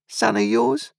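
high, prying voice; sly stranger. A narrator's reading. The stranger asks Joe about Pip, sly and high. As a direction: probe with a sly question.

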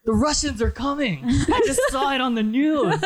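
high mock warning, laughing through it; alarmed old man. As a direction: imitate a panicked old man while cracking up.

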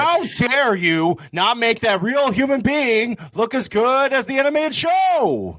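high, slow mock outrage; mock outraged fan. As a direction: drag it out like an indignant fan. Mocking angry fans.

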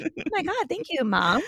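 high-pitched sarcastic thanks to mom; mock thanks. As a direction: squeal a fake-grateful reply, pitched right up. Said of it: "And you're like".

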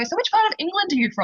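high, bright mock questioning; lightly voiced American customer asking her origin. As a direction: imitate a clueless diner asking. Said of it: "they always go".

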